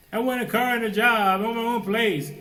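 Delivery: high, loud whining want; mock a spoiled kid's demand; whiny youth. Voicing a whiny young person.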